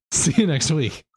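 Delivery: laughing. Real, not acted. Laughing sign-off.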